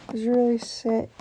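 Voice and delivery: normal talking voice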